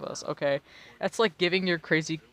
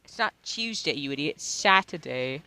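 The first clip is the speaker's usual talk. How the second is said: mock British. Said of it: "in my British tone".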